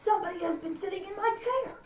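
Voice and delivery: high-pitched indignant bear, rising at the end; huge bear. Acted. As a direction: complain as the huge bear, voice climbing. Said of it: "again the huge bear says".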